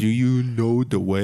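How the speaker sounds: lightly voiced mock African accent meme. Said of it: "in a mock African accent".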